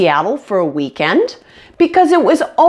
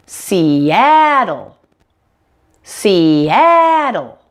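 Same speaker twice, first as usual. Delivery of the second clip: SEATTLE with the middle A much louder and longer; middle-syllable stress; much slower than usual. Shown as a demonstration. Teacher showing how it sounds, long stressed A in SEATTLE. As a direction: slowly say see-AA-tl, leaning on the middle vowel of SEATTLE. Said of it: "a sound is much louder and longer".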